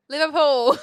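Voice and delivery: loud, high, laughing British accent. Shown as a demonstration. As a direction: blurt it in a mock British accent. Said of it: "hit me with your British accent".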